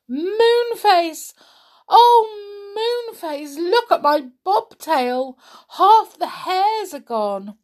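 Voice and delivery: scared rabbit. Acted. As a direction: cry out in fright for Moonface. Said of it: "looking very scared".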